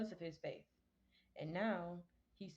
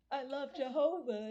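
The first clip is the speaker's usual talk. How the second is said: high and loud, cooing the verse; cutesy high voice; 7 semitones higher, 8 dB louder than usual. Shown as a demonstration. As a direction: read it like it's adorable. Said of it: "It just looks so cute".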